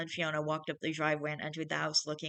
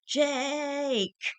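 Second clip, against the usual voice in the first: high, drawn-out whine of a name; protesting cry; 10 semitones higher, 4 dB louder than usual. A narrator's reading. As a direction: call out to your brother in complaint. Pinned brother cries out in protest.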